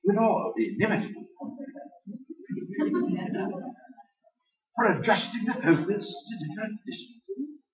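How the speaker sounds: lightly done British English reading; British accent. Shown as a demonstration. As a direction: read the quote as a Brit. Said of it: "Let me say it in British".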